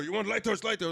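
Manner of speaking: high, loud pushy insistence; mock aggressive vendor; aggressive seller. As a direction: shove the lighter rug at them. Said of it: "the aggressiveness in his voice".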